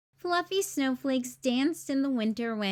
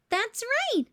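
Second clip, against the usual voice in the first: cheerful Barney; 10 semitones higher than usual. A narrator's reading. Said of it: "said Barney".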